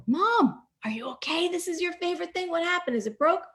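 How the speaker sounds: high-pitched, incredulous string of questions; dismayed child. Retold or imitated. Child finds mom's favorite radio in pieces, dismayed questioning. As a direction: pitch it up in worried disbelief.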